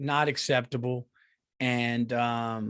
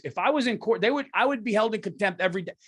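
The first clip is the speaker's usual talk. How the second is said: normal talking voice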